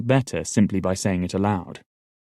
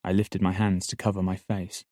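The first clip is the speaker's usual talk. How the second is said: usual speaking voice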